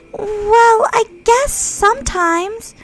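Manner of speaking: high, loud, grudging; defensive schoolgirl answering; Natsuki's voice. Acted. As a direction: brush him off.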